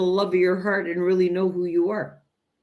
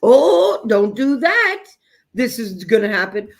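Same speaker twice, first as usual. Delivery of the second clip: hammed-up parental alarm, higher and louder; mock fearful parent; 3 semitones higher, 7 dB louder than usual. Parent warning a child out of fear, mock alarmed. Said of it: "parents operate their parenting out of fear-based".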